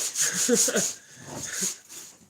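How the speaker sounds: loud burst of chuckling; laugh. Real, not acted. Streamer after getting stuck on super glue, laughing.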